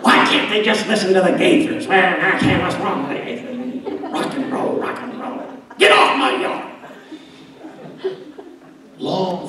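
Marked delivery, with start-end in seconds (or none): 0.0-6.6 put-on grumpy old churchgoer. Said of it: "saying things I used to make fun of".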